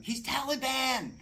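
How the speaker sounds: hammed-up frantic wife shouting Taliban; panicked scream. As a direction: yell it in terror as the panicking wife. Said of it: "she starts screaming".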